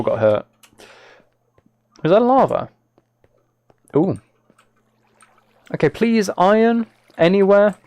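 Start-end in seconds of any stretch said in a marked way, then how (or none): none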